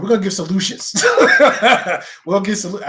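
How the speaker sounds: laughing. Real, not acted. Laughs mid-sentence.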